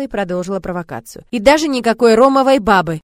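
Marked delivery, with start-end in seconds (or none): 1.3-3.0 loud. A narrator's reading. Teasing threat to the familiar.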